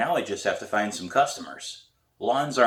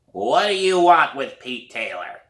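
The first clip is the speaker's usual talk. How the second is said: slow, louder suspicious challenge; hoarse old woman; 5 semitones higher, 6 dB louder than usual. A narrator's reading. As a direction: demand it like a nosy neighbour. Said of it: "It's a hoarse voice".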